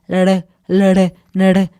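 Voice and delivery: lere with a soft tapped R after L; Lere, lere, lere with a weak tapped R; soft R demo. Shown as a demonstration. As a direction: say "lere" with a light tap, showing it fails. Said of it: "No se puede".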